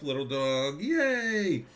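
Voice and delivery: high, bright squeal of joy at the dog; excited pet talk. Real, not acted. Cheering to her dog.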